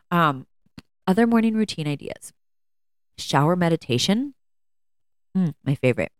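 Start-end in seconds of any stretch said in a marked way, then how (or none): 5.4-6.1 talking around a cough drop; speaking with a lozenge in mouth; mouth full. Real, not acted. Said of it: "Excuse me, I'm eating a, um, a cough drop".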